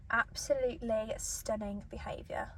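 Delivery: soft slow whisper. Real, not acted. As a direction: breathe it out slowly. Mukbang whisper over food.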